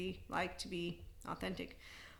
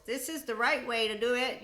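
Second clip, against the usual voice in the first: louder and higher, preachy mock certainty; mock know-it-all; 5 semitones higher, 6 dB louder than usual. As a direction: lecture like someone who has all the answers. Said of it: "putting all their books on pedestals".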